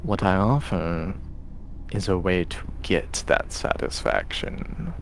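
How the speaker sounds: slow, soft, knowing taunts; taunting tempter voice. Acted. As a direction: needle him like a voice inside his head. Tempter voice closes the vision.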